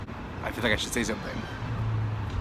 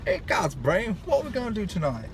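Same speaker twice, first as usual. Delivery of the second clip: high, fast cartoon mouse voice; squeaky cartoon mouse imitation; Pinky and the Brain voice; 7 semitones higher than usual. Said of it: "I'm not good at that stuff, accents".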